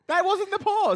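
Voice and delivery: high, loud objection; insist, laughing it off; real playful outburst; playful loud protest. Real, not acted. Playful argument about pausing.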